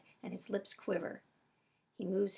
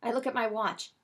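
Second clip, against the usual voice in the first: ordinary delivery, nothing marked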